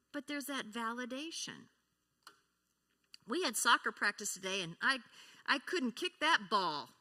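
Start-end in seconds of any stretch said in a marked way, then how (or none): 3.3-6.9 put-on louder, upset child voice; child upset after a bad soccer practice, upset; upset telling. Shown as a demonstration. Child tells parent about bad practice, louder and upset. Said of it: "it's the same statement, but our tone of voice".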